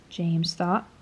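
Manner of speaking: ordinary delivery, nothing marked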